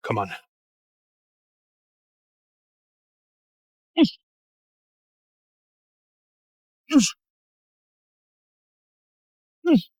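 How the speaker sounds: forced grunts mid-lift; straining grunts. Real, not acted. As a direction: push each word out through effort. Straining through a heavy set.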